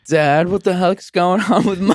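put-on high, whiny kid voice, pleading; high whine of a scared teenage son. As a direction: play a frightened boy begging his dad. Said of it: "Peter's like".

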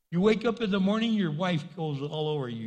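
usual speaking voice

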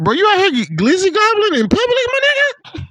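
high teasing jab at a fan; mocking. At a ballgame, teasing a hot dog eater mockingly. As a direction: ridicule him in a mock-high voice. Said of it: "I used to make fun of".

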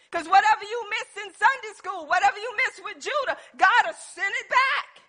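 fired-up preacher in full cry; impassioned preaching. Real, not acted. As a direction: preach it hard to the congregation.

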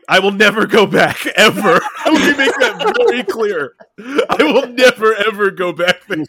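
NEVER and EVER pitched high and loud; emphatic vow. Real, not acted. Swearing off going back to Jersey, emphatic NEVER.

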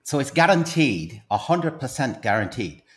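salesman pitch in a put-on Irish accent; stage Irishman with a secret to share; Irish accent, pitching his invention to the host.

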